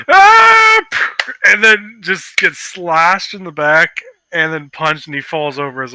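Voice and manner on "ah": loud high yell of panic; high panicked shout. Acted. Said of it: "so he goes".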